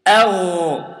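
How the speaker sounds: أغ with a strong throaty gh; ghain demo. Shown as a demonstration. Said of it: "I want you to say it after me".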